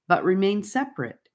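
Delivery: ordinary delivery, nothing marked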